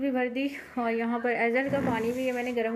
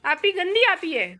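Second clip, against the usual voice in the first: higher, louder playful teasing; 6 semitones higher, 5 dB louder than usual. Real, not acted. Teasing baby, high voice.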